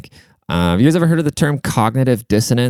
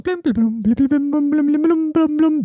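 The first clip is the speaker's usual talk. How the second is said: high-pitched mimicked chimey plinking; high, bouncy "blim" notes; imitating instrument. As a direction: play a tapped metal drum with your mouth. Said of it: "He's going".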